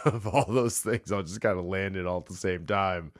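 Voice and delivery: ordinary talk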